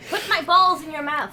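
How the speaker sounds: loud, laughing demand; playful shout. Real, not acted. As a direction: shout it teasingly.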